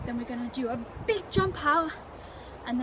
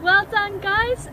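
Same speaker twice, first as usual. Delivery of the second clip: loud praise; 6 semitones higher, 7 dB louder, slower than usual. Real, not acted. Teacher praising the class loudly.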